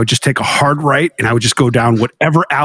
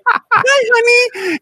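high sugary mock wife voice; mock sweet hi honey; sweet greeting; much slower than usual. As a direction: chirp it like a sing-song hello. Wife greeting him in retold scene.

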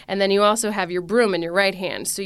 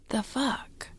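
soft, whispered swear of disbelief; 5 semitones higher, 8 dB quieter than usual. Retold or imitated. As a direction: breathe the swear out in baffled disbelief. Retold baffled reaction.

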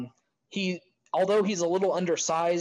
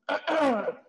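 coughing up water; 10 semitones higher than usual. Real, not acted. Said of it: "the water went down the wrong pipe".

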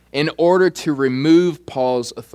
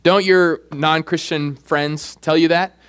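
ordinary talk, nothing marked

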